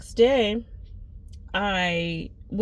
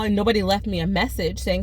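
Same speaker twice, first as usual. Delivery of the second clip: usual speaking voice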